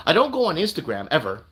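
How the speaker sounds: ordinary talk, nothing marked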